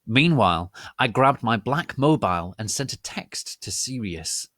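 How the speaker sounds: plain reading voice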